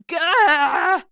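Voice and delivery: sudden high loud yelp; shocked shout of dismay; startled yelp. Acted. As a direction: jump out of your skin with a cry. Sokka startled by makeup.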